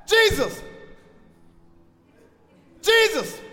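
high, loud call of a name; screaming out. Acted. Disciples calling Jesus from the boat, screaming. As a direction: cry out to him across the lake. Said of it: "they start screaming".

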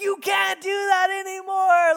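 high, full-force angry shout; yelling in his face. Retold or imitated. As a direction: re-enact a dad losing it, screaming. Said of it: "got on his face".

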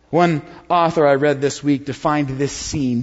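usual speaking voice

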